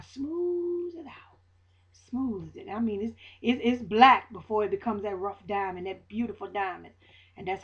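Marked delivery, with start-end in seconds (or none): 0.0-2.7 soft, slow, hushed words; smooth and gentle. Real, not acted. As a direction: let the words glide out softly. Describing God smoothing things over.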